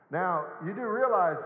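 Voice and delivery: ordinary delivery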